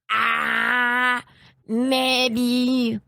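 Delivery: drawn-out, clueless Scottish hesitation; hammed-up Scottish dithering; bad Scottish accent. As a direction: imitate a dim Scottish shopkeeper badly. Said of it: "That was a bad accent".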